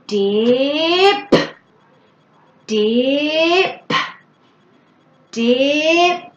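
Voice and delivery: "dip" drawn out, rising, with a popped p; emphasized last sound. Shown as a demonstration. Parent encouraging clearer speech, "dip" with a big popping p. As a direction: stretch each "dip" upward and pop the final p. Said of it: "emphasizing the last sound".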